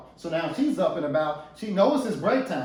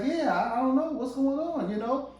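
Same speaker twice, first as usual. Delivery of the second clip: defensive wife; 8 semitones higher than usual. Acted. Wife answering in tense talk.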